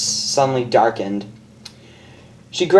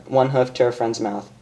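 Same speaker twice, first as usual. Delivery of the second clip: ordinary delivery, nothing marked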